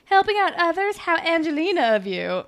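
high, loud, put-on snide voice; mock snark. Shantae needles Claire over the clothing drive, mock snark. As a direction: imitate a snarky schoolmate.

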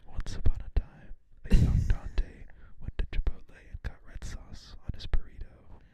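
eerie unvoiced whisper; spooky whisper. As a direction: whisper it like a ghost story. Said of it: "tell your stories like that".

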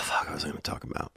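whispered under breath to self; hushed self-muttering; whispered aside. Real, not acted. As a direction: drop to a whisper, losing the thread. Forgets topic, mutters aside.

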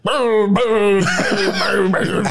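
mock incantation. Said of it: "the crazy language that he speaks".

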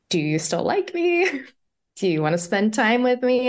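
high, small, insecure questioning; sheepish, exposed little question; vulnerable plea. Retold or imitated. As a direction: act out timidly asking for reassurance. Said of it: "I was vulnerable".

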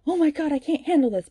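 high, loud mock wail; hammed-up high distress; mock distraught heroine. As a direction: overact a heroine at her limit. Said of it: "she's like".

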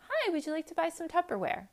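high, chirpy door-to-door seller; Tupperware lady pitch. Acted. Tupperware lady greeting you at the door, high sales voice. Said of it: "Tupperware lady".

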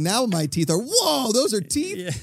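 infomercial voice. Retold or imitated. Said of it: "they're like".

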